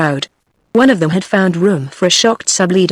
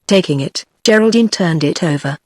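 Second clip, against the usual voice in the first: ordinary delivery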